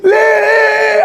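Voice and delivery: hammed-up shouting pharaoh; frantic shout. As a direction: yell it in a frantic high cry. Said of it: "Paro in his pajamas running around Egypt going".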